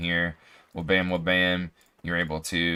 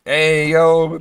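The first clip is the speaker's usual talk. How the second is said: higher, louder hype call; hyped shout; 7 semitones higher, 9 dB louder than usual. Real, not acted. Streamer spots a viewer bonus and calls out, pumped and loud.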